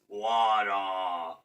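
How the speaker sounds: lightly voiced giant saying water. Shown as a demonstration. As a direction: rumble it like a giant. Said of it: "that sounds more like a giant voice".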